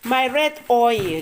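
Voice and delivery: high, loud gleeful showing off; thrilled cry to the viewers; excited. Real, not acted. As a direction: look look, bursting with delight. Showing off red oil gleefully.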